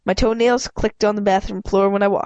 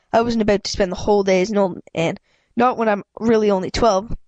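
ordinary delivery, nothing marked

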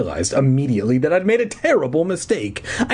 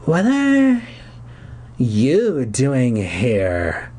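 cold, hoarse; much slower than usual. A narrator's reading. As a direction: drag the words out coldly in a hoarse voice. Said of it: "she asked coldly. Her voice was hoarse".

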